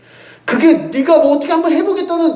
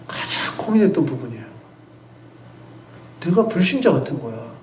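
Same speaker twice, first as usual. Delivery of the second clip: normal talking voice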